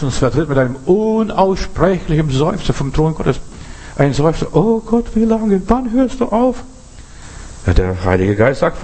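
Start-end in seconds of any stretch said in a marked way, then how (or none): 4.5-6.6 high, pleading cry to God; a desperate sighing prayer, pitched high; anguished plea, high and strained. Acted.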